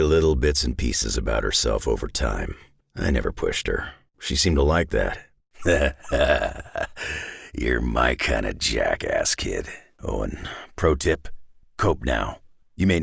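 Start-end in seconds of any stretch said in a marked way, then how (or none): 5.6-7.5 put-on a dry amused chuckle at the kid; gruff chuckle. Wolverine chuckling at the kid he likes, gruff chuckle.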